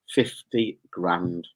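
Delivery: FIFTY GRAND drawn right out, low; slow emphatic repeat. Real, not acted. Repeats prize slowly for emphasis.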